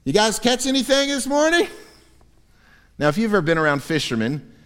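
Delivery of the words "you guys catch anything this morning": high friendly call across the water. Retold or imitated. Jesus calling from the shore to fishermen, high and friendly. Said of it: "he shouts to them".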